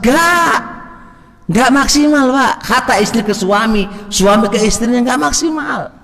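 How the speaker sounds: impassioned preacher's cry; fiery preaching. Acted. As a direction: drive the point home with fervour. Preaching at full force.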